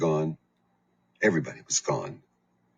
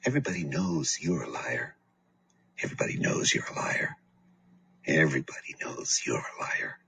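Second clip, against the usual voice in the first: quiet threat; 4 dB quieter than usual. A narrator's reading. Boyfriend threatens child.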